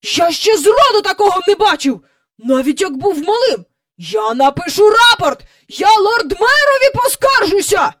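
high, loud, blustering complaint; shouted threats. A narrator's reading. Park keeper threatening to complain to the Lord Mayor, indignant shouting. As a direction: threaten an official report in outrage. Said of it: "викрикував свої погрози в небо".

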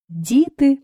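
ordinary delivery, nothing marked

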